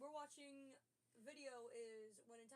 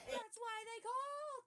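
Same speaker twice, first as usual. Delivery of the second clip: loud exclaim; 11 semitones higher, 12 dB louder, slower than usual. Real, not acted. Loud reaction to arrest video.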